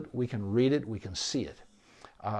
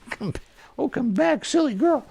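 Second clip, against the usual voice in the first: much higher, louder playful call; fond call to dog; 14 semitones higher, 7 dB louder than usual. Real, not acted. Owner calling sleepy dog back to say goodbye, high playful coaxing. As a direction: coax her back up in a high sing-song.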